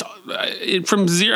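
ordinary delivery

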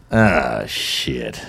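low, sinking groan of reluctance; resigned dread. Acted. Comedian recalling being asked to Afghanistan, resigned. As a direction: let the curse drop low and heavy.

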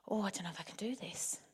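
low, hushed inner doubt; nervous hushed thought. Acted. Panicking swimmer thinking to herself as the boat nears, nervous hush. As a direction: think it aloud, very softly and uneasily.

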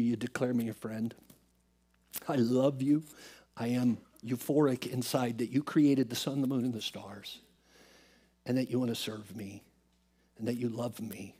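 low, soft prayer with long pauses; humble prayer. Retold or imitated. Man praying to the Lord for Ryan, humble prayer. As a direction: thank him quietly and sincerely. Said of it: "my prayer for Ryan last night and this morning".

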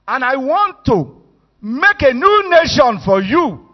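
loud, high, exasperated command; God losing patience, booming out; angry God. Acted. God fed up with Israel, loud angry outburst.

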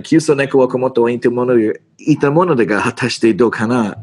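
fast, rattled-off Japanese gangster slang; Yakuza slang Japanese. Shown as a demonstration. Actor shows the line as finally learned, fast Yakuza Japanese. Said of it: "you have to say it like".

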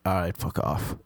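low, soft brush-off; a flat, quiet dismissal; dismissive. Retold or imitated. Brushing off an annoying girl, quiet and dismissive.

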